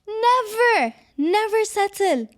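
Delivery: never settle called out high and loud; high emphatic shout. Real, not acted. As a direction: shout it like a rallying cry.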